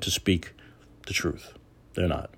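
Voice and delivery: hushed conspiratorial whisper. Real, not acted. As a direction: whisper it like a forbidden secret. Whispered secret.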